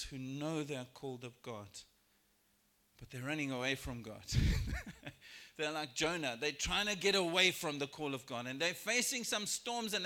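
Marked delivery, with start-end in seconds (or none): 4.3-5.5 short high burst of laughter. Real, not acted. Preacher laughing at people running from God, a high laugh. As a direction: break into a quick high laugh.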